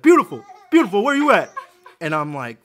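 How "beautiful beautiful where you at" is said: high, hollered imitation of a father; mock calling out. Father calling for his little girl, exaggerated loud holler. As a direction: yell across the store, hammed-up.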